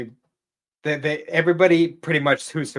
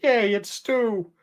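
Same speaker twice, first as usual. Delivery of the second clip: high, drawn-out happy cheer; hammed-up delighted welcome, very slow and high; Stephen King; 10 semitones higher, slower than usual. Happy gatekeeper greeting returning friends, mock-gleeful cheer. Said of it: "Stephen King's all happy".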